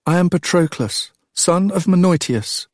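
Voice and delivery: put-on a nervous boy announcing himself, high and scratchy. A narrator's reading. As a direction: force out the words after long silence. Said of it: "My voice sounded high and scratchy with disuse".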